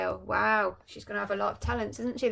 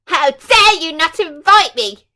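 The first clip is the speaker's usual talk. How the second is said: furious villain roar, much louder and higher; the mean fairy, snubbed, roaring at the king; 8 semitones higher, 19 dB louder than usual. Acted. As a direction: blast it at the whole court. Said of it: "she roared".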